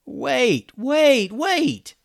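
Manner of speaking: rising, panicky high calls; yelled. Acted. Ben calling after the pirates, panicking. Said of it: "yelled Ben".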